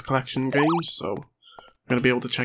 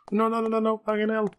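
high, panicky put-on cursing voice; imagined game character's voice; 10 semitones higher than usual. Player voicing the on-screen character in panic, high put-on voice. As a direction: squeal the swearing as the imagined little character. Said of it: "that's how I imagine him saying".

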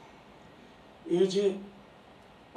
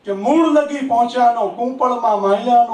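raised, sing-song reciting voice; poetry recitation; 6 semitones higher, 11 dB louder than usual. Acted. Poet reciting his verse to an applauding audience, raised and sing-song. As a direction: declaim each line to the hall, savouring it.

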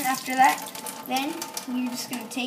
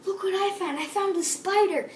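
put-on excited kid; 7 semitones higher than usual. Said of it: "you're gonna be like".

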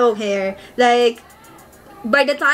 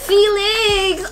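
excited squeal; 7 semitones higher than usual. Real, not acted. Fan spots her bias's photo.

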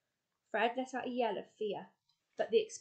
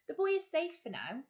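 sharp; 8 semitones higher, 3 dB louder than usual. A narrator's reading. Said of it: "His voice was sharp".

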